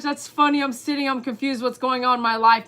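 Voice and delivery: ordinary talk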